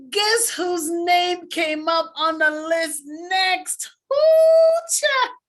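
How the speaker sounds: slow build then a high, loud ooh; excited reveal. Real, not acted. Telling the crowd her name came up, slow then exuberant. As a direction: draw it out, then burst high.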